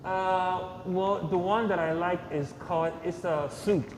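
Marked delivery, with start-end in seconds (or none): none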